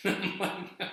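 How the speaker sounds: breathless laughing fit; cracking up. Real, not acted. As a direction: laugh so hard the words barely get out. Cracking up at his own story.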